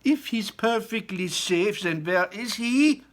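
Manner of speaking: high, loud slow snap; snapping. Acted. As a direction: snap at him. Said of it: "snapped Missus Gloop".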